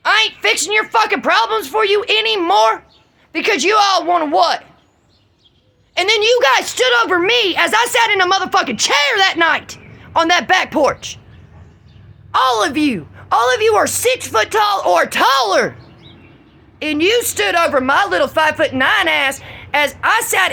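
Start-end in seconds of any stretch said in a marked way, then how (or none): 0.1-4.6 higher, rising rant at the family; retold angry rant. Telling the family she's done, heated and higher. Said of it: "I said". 6.0-19.4 higher, near shouting; furious, voice climbing; angry rant. Real, not acted. Ranting at the family who stood over her, furious.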